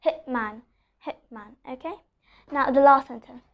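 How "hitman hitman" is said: "hitman" said softly with a stopped t; don't release the t in "hitman"; "hitman" with the t swallowed before m; unreleased T. Shown as a demonstration. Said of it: "I'm not pronouncing the letter T".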